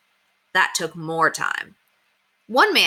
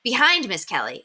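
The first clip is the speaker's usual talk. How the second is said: usual speaking voice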